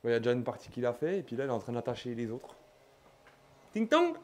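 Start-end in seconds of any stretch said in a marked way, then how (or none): 3.7-4.1 high sung ding-dong; doorbell chime. Pretend. Imitating a doorbell.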